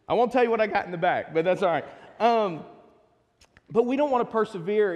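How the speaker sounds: ordinary talk, nothing marked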